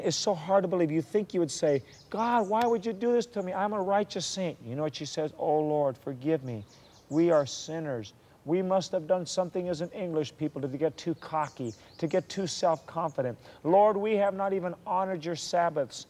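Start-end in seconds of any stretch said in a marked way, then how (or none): 2.1-4.6 high-pitched mock protest to God; indignant complaint. 5.4-16.1 fervent preached prayer of repentance; earnest confession. Acted. Voices her confession in prayer.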